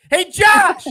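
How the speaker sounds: high, full-voiced shout; yelling across the map. Host yelling to his co-host in Florida, long-range shout. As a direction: holler the name over a great distance. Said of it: "trying to yell across the map".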